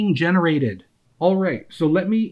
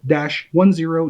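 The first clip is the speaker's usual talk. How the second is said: ordinary delivery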